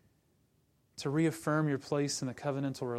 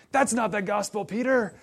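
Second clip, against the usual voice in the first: louder, higher mock-outraged "Peter!"; indignant rebuke; 6 semitones higher, 7 dB louder than usual. Paul rebuking Peter over the gospel, mock shout. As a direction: call Peter out with a hammed-up shout. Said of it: "Paul's like".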